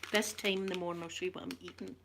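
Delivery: ordinary talk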